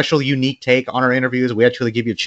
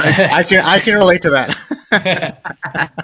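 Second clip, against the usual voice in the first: laughing; 4 semitones higher, much slower than usual. Real, not acted. Laughing at not being young.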